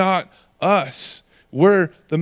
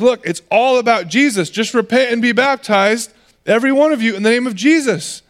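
higher-pitched, urgent proclamation; impassioned preaching; 6 semitones higher than usual. Acted. Peter urging the crowd to repent, impassioned preaching. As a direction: preach it out with rising fervor, pitched up.

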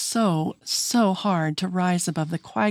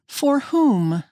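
incredulous; 9 semitones higher than usual. A narrator's reading. Connie questions.

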